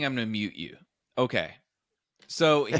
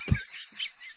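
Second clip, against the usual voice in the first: high unvoiced laugh; 6 dB quieter than usual. Real, not acted. Laugh after acted shout.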